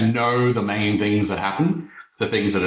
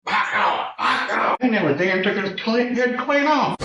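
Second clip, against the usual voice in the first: high-pitched outburst; 13 semitones higher than usual. Pretend. Sudden hammed-up high outburst.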